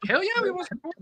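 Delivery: high-pitched mock enthusiasm; eager cheer. Imitates other team's eager reply.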